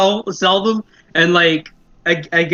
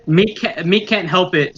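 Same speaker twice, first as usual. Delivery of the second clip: ordinary talk, nothing marked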